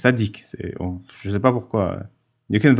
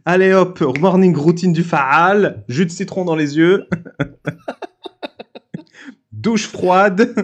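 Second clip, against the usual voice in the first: hype coach; 5 semitones higher, slower than usual. Pretend. Mock fitness guru hype.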